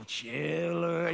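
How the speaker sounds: imitating brother's drawn-out horror call; spooky teasing voice. Big brother scaring a little kid, mock spooky voice. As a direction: drag the word out to scare. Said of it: "my brother said, He's gonna get you".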